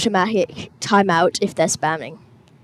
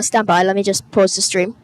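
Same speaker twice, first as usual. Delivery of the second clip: ordinary talk, nothing marked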